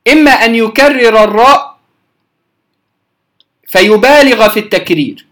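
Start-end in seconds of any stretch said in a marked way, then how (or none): none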